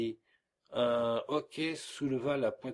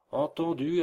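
louder, higher emphatic assent; emphatic agreement; 5 semitones higher, 7 dB louder than usual. A narrator's reading. Postilion seconding the sick man, emphatic. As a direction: back him up with a raised voice. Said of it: "appuya un des postillons".

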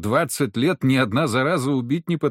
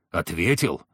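lower, held-in disbelief before the outburst; low incredulous echo; 6 semitones lower than usual. A narrator's reading. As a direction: repeat it low, as if hardly believing it. Furious hunter echoes the word. (глухо, недоверчиво переспросить)

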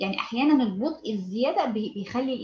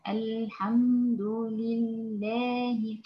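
"الحمد لله" drawn out very slowly and softly; slow it down until the meaning breaks; very slow recitation; 4 dB quieter, much slower than usual. Shown as a demonstration. Teacher showing how overly slow reading loses the listener. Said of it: "يعني مثلًا".

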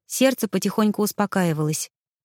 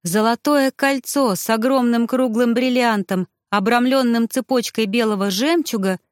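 ordinary delivery, nothing marked

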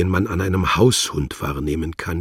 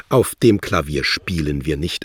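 usual speaking voice

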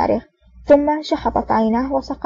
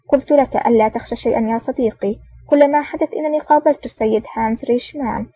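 ordinary delivery, nothing marked

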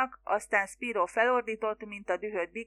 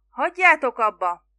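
loud, higher command to stop; shouting; 3 semitones higher, 11 dB louder than usual. A narrator's reading. Mother shouts at sons firing revolver, loud and higher. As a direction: shout them down over the gunfire. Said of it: "kiáltott mama".

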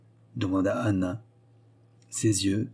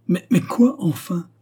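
high, loud exasperated outburst; exasperated cry; 9 semitones higher, 4 dB louder than usual. Acted. Anna losing patience with Letty's story, exasperated cry. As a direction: burst out, at the end of her patience. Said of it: "s'écria Anna, exaspérée".

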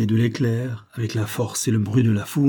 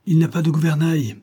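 high-pitched exclamation of alarm; exclaiming; 7 semitones higher, 3 dB louder than usual. A narrator's reading. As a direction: cry out the bad news. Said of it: "s'écria le lieutenant". (s'écrier, voix haute)

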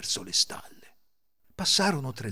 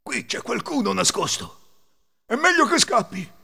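high, alarmed, louder at the end; frightened; 11 semitones higher, 6 dB louder than usual. A narrator's reading. Thief whose hair stands on end, frightened alarm. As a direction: grow panicky and decide to flee. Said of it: "si rizzarono i capelli".

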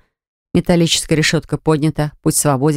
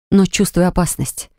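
plain reading voice

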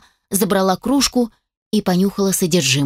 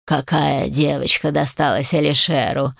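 amused undead creature; 6 semitones lower than usual. A narrator's reading. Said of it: "протянул он сквозь смех".

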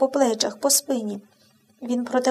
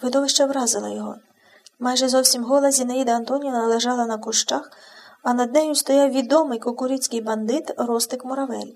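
plain reading voice

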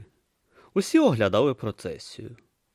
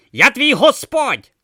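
indignant tortoise god; 11 dB louder, slower than usual. Acted. Om insists he is god.

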